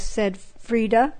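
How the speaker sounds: usual speaking voice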